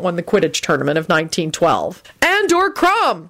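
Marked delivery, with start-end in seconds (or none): 2.2-3.3 high, drawn-out exasperated call; exasperated shout. A narrator's reading. Ron and Harry giving Hermione the answer, exasperated shout. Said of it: "shouted in exasperation".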